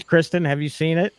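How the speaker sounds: ordinary talk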